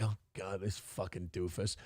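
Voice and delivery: a disgusted aside, barely voiced; muttered aside. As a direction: grumble it quietly to yourself. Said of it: "I'm like".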